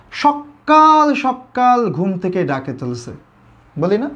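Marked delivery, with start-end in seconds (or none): 0.1-3.1 drawn-out, emphatic "সকাল" with a doubled k; emphatic doubled consonant. Shown as a demonstration. Teacher voicing a complaint about being woken early, emphatic. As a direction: lean slowly and hard on the k in "সকাল". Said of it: "সকাল জোর দেওয়ার জন্য কী করলাম".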